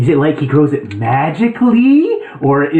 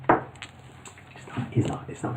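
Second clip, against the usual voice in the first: whisper; 17 dB quieter, much slower than usual. Real, not acted. Whispered aside to player.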